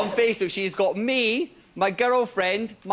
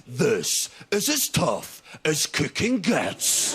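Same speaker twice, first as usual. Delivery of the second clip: MasterChef announcer; 3 semitones lower, slower than usual. Retold or imitated. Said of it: "The start of MasterChef is this".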